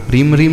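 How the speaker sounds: रीम starting straight on र, no ह; wrong pronunciation demo. Shown as a demonstration. Teacher warning students against a slip, ह missing from रीम. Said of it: "नहीं".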